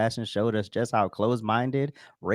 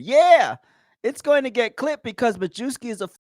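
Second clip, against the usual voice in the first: higher and louder, sneering mock reading; 10 semitones higher, 5 dB louder than usual. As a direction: read it as a snide commenter. Reading his favorite hateful comment.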